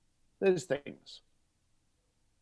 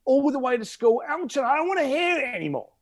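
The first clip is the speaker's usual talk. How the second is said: put-on much higher, complaining teen to mum; whining teenager; 9 semitones higher than usual. Teenage son begging mum, higher whine. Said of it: "I used to say to Mum".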